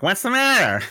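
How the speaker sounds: high-pitched put-on voice, imitated question; Frank. Imitating a friend butting in at the lunch table, high put-on voice. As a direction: mimic a friend piping up with a question. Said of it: "true to form, Frank's like".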